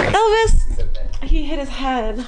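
high squeaky pet talk. Real, not acted. Talking about the cat.

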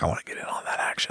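hushed, fast quoted aside; whispered quote. Retold or imitated. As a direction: drop to a quick whisper. Quoting listener, voice drops to whisper.

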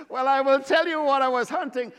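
usual speaking voice

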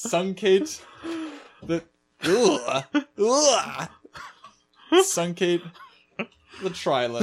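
"Truncate the trilence" stumbled through with groans; jumbled syllables. Shown as a demonstration. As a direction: drag out "trilence" as a fumbled spoonerism. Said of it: "A spoonerism".

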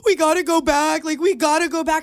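retold child's upset plea; imitated freaking out. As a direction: plead like an upset little kid, lightly acted. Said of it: "freaking out ... bawling his eye".

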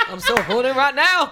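high, loud put-on diva voice; hammed-up, belted celebrity impression; Angela Bassett. Woman repeating her fake come-on to co-host, hammed-up impression. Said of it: "Oh, Angela Bassett".

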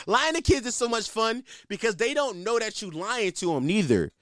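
ordinary talk, nothing marked

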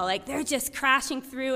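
ordinary delivery, nothing marked

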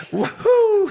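high, loud whoop; whooping at friends' joke; woohoo cheer. Real, not acted.

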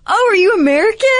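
high, bright imitation of a curious waitress; waitress voice, no accent. Retelling the cafe waitress asking if she's American, high mock voice. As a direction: mimic her eager question, accent dropped. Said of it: "she obviously had a British accent".

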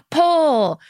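hammed-up stadium singer call; arena rocker call. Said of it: "So she would be like".

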